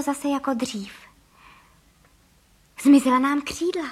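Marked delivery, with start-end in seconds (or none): 2.8-3.9 loud, excited cry; loud burst of amazed relief; joyful exclamation. A narrator's reading. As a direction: exclaim it loudly in delight. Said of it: "vykřikl pan Frejka".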